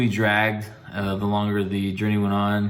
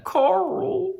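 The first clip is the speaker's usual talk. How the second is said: mock Carl yell. Walking Dead meme shout.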